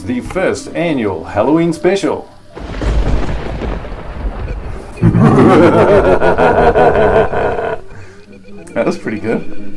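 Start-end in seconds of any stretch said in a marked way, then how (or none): 0.0-2.3 announcer intro. Acted. 2.5-8.7 villain laugh. Acted. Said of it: "do I get to do an evil laugh".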